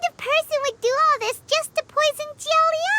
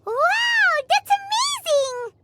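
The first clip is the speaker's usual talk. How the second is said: delighted wow; 7 semitones higher, slower than usual. Acted. Paimon gushes over a stronger, healthier life. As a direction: gush over the idea.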